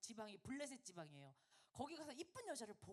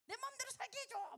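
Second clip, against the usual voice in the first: high, indignant mock plea; acted angry protest; 12 semitones higher, 5 dB louder than usual. Child demanding their own way from mum, mock-angry high protest. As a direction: whine back at mum like a rebellious child. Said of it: "엄청 싸우겠다, 그지?".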